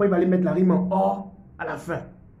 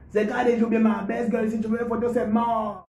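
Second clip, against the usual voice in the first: mock Ivorian rap flow, higher; Ivorian accent; 7 semitones higher than usual. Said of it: "l'accent ivoirien". (flow ivoirien imité, plus aigu)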